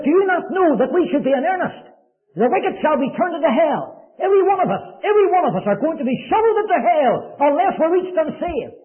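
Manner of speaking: urgent pleading. Retold or imitated.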